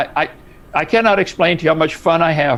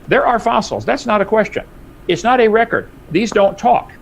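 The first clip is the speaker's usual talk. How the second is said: emphatic rise; 6 semitones higher than usual. Real, not acted. Heated debate, mocking fossil record.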